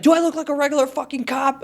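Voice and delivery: confrontational cop. Retold or imitated. Storyteller imitating the cop confronting him, mock confrontational. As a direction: play the cop throwing out a challenge. Retells the cop's challenge.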